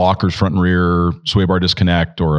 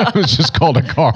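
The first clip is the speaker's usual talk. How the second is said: laughing; 10 semitones higher than usual. Real, not acted. Laughing at the joke.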